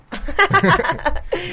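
short giggle; a quick nervous giggle; nervous laugh. Real, not acted. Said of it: "esa risa nerviosa".

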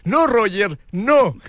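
high scolding call to the player; mock line judge. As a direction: call out a firm ruling, high-pitched. Acting line judge scolding Roger. (reclamo agudo de juez de línea)

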